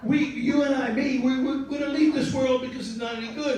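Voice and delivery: high, plaintive mock pleading; desperate insistence. Rick insists on dying together.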